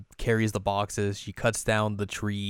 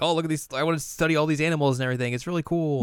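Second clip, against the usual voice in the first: higher, very fast gushing; excited Riko; 6 semitones higher, much faster than usual. Retold or imitated. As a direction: rattle off with eager excitement. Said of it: "and she's like".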